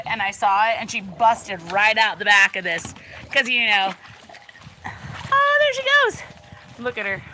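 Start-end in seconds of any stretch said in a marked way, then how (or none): 5.3-6.2 sudden high squeal of delight; excited. Real, not acted. Watching her pig bolt, excited high cry. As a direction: pitch jumps way up as the pig runs.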